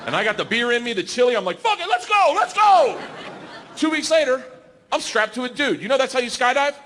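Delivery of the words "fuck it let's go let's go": high, reckless acted war cry; hyped-up shout. Comic with beer in him accepts the dare, high hyped shouting. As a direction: fire yourself up loudly.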